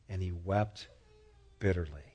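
low, soft, grieving narration; quiet heavy sadness; hushed sorrow. A narrator's reading. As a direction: let the words sink almost to nothing. Peter weeps after denial.

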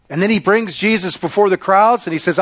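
ordinary delivery

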